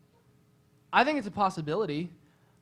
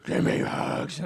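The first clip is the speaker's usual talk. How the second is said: growly werewolf voice; 4 dB louder, much slower than usual. Acted. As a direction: rasp it like a werewolf. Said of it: "the werewolf voice".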